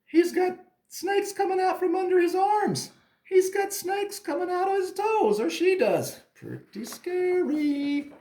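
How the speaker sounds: very high, squeaky amazement; playful horror. Pretend. Teacher showing kids a snaky monster, mock-scared squeal. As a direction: squeal it, stretching the final words.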